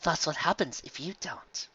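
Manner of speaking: slow, unvoiced chilling whisper; wintry whisper. Carrot Top finishes the threat, cold whisper. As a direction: breathe the threat coldly. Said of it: "soft smile was wintry".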